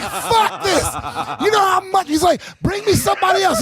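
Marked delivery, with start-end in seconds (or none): 0.0-0.8 high, shouted rant of a furious fighter; livid. Acted. 2.6-3.6 high wrestler-style challenge; livid. Acted. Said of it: "like it was wrestling".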